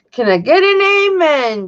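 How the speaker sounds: high, loud call for an amen; mock church call-out; preacher call. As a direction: belt it like a preacher. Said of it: "Johnny says".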